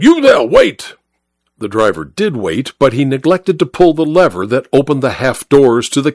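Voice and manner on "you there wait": yell. Acted. Narrator chases the third cab, much higher yelling call. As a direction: shout after the departing cab. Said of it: "I yelled".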